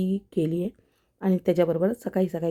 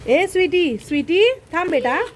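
high, loud call to a pet; raised, high-pitched coaxing call; 9 semitones higher, 4 dB louder than usual. Real, not acted. Calling the dog Sweety over.

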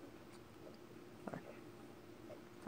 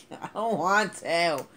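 whiny; 4 semitones higher, 10 dB louder, much slower than usual. Acted. Whining at a scary game level.

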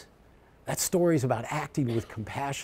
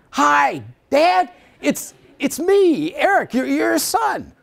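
much higher, louder, bursting with eagerness; eager son; 14 semitones higher, 8 dB louder, slower than usual. Retold or imitated. Son calling his father at night, eager and loud. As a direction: announce yourself to your dad like an excited kid.

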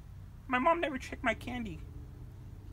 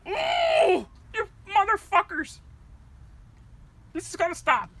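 a sudden high, loud yelp of rage; losing it on camera; exploding outburst; 5 semitones higher, 6 dB louder than usual. Acted. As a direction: blow up at them. Outburst after sigh.

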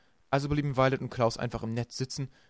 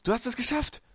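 loud, much higher shout up the shaft; roaring; 12 semitones higher, 5 dB louder than usual. A narrator's reading. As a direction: bellow it up to his sister. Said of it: "brüllte Klaus". (laut hinaufgebrüllt)

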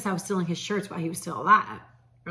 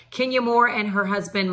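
normal talking voice

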